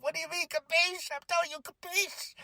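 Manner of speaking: high hammed-up retort; mock the confused reply; a hint of a mocking wrestler; imitating. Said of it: "he's like".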